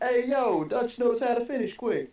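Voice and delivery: put-on high loud New York accent; hey-yo New York tough guy. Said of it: "Say in a New York accent".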